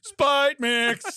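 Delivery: loud hyped rapper shout; rapper hype call. Retold or imitated. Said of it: "be like".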